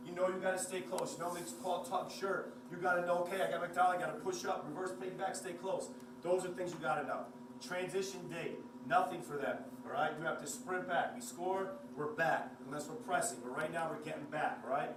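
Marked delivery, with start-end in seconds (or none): none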